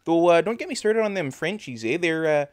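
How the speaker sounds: put-on thick Canadian hoser accent. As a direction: lay on the folksy Canadian accent, with "eh". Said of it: "have an accent like this".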